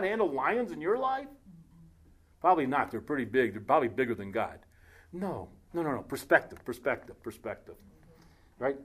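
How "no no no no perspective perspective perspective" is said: softer and softer; dying down to almost nothing; fading repeat. Real, not acted. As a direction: let each repeat fade away. Correcting the joke, fading.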